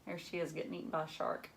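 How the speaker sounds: usual speaking voice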